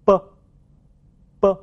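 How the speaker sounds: b as an unvoiced French p, lips shut then released suddenly; plosive consonant. Shown as a demonstration. Teacher models for repetition, b as a sudden voiceless burst. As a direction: pop b out from tightly closed lips, cords still. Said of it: "受阻后突然冲出口腔".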